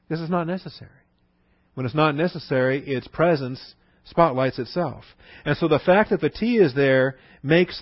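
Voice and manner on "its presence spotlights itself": usual speaking voice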